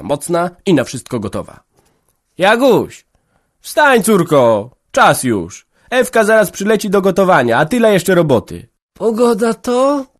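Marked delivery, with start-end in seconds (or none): 2.4-8.7 put-on loud, high call of an old mother. A narrator's reading. Mother waking daughter at dawn. 8.9-10.1 put-on slow, high drowsy voice of a young woman just woken; sleepy question. A narrator's reading. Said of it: "pytała, podnosząc ociężałą głowę".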